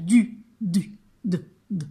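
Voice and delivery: "du" loud and separate, shrinking to a short "de"; phoneme sounding-out. Shown as a demonstration. Teacher narrowing a syllable to its first sound, "du" down to "de". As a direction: cut "du" down to the bare d of "de". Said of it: "J'entends de".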